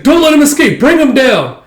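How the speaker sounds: high, loud battle cry; a soldier yelling orders at the troops, high and loud; shouted order. Acted. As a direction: yell the command at the troops.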